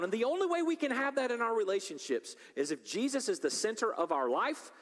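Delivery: fervent sermon delivery; impassioned preaching. Acted. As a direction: preach it hard to the congregation. Preaching at full force.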